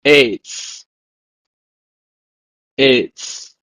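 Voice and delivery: eitz said "ayts" twice; "Eitz" said twice, short; Hebrew word. Shown as a demonstration. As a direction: model "eitz" then repeat. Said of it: "It's pronounced in Hebrew as".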